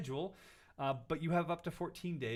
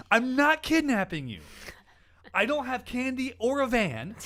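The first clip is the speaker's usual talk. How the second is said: exasperated reply; 7 semitones higher, 7 dB louder than usual. Retold or imitated. Host retelling his reply to an angry customer, loud and exasperated. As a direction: spell it out as if to a stubborn child.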